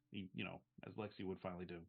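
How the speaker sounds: usual speaking voice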